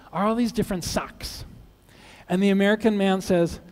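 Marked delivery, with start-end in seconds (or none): none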